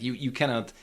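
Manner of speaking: usual speaking voice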